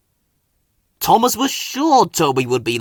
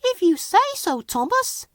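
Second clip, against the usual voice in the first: very high chirpy tram voice; bright little agreement. A narrator's reading. Flora agreeing with Thomas, chirpy and high. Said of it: "she chuffed chirpily".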